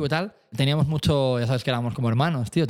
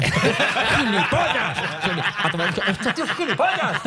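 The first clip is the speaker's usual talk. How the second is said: mock distant yelling; 6 semitones higher, slower than usual. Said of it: "se empieza a escuchar a Nacho".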